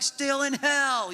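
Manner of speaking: high, drawn-out exasperation; exasperated reply. Acted. Dad answering his wife from the line, exasperated. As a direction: stretch it out in mock misery. Texting wife back, losing patience.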